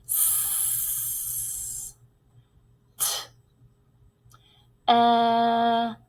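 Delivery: "S, T, E, F" as long isolated phonics sounds; sounded out slowly. Shown as a demonstration. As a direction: stretch "S", "E" and "F", with gaps between. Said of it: "starting with that first sound".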